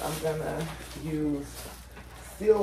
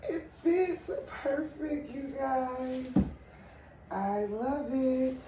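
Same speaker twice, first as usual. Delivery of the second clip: teary; 9 semitones higher, 5 dB quieter, slower than usual. Real, not acted. Emotional unboxing of baby gift.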